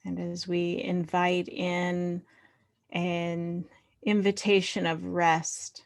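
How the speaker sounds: unhurried meditation voice with long pauses; guided meditation. Real, not acted. Leader inviting the group to find body and earth, guided meditation. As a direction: settle them gently into body and breath.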